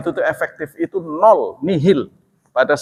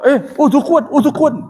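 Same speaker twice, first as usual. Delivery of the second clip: much higher, strained voice of a possessed man; possessed man resisting; 12 semitones higher, 4 dB louder than usual. Retold or imitated. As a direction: play the possessed one refusing to pray. Person told to say istighfar.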